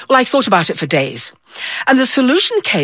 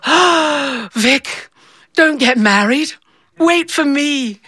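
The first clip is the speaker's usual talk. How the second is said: lovesick plea; 6 semitones higher, much slower than usual. Retold or imitated. Girl's lovesick inner plea.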